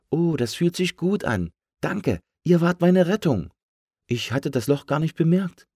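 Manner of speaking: high, relieved cartoon balloon; delighted little balloon voice; grateful balloon. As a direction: pitch it up, full of thanks. Balloon thanks its rescuers.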